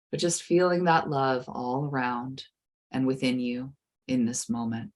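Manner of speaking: soothing guided meditation. Acted. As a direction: ease the listener gently into calm.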